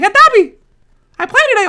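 mock the young boy's voice; kid voice imitation. Imitating young Salty at tournament.

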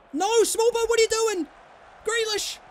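high, loud alarmed shout at the player; panicked yell. Real, not acted. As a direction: yell in panic at a teammate's error. Panicking at a mistake.

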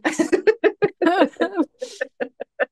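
high, loud delighted laugh; giddy laugh. Real, not acted. Woman laughing at her own hippie pants after meditation. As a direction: laugh out at yourself.

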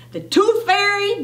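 high, incredulous little-brother question; startled query from a brother; surprised question. A narrator's reading. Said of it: "Bodine asked".

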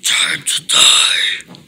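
slow, breathy menacing whisper; creepy villain whisper. Pretend. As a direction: hiss it out like a monster. Villain toy threatens.

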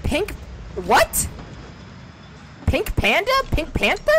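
half-shouted confusion at the voice; incredulous. Real, not acted. Baffled streamer shouting back at Cortana, incredulous.

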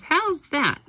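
lightly voiced character. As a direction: ask it as the little figure, lightly played. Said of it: "and then he says".

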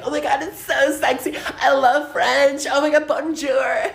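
mock a dreamy fan gushing over French. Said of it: "and you're like".

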